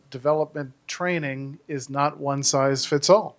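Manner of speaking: usual speaking voice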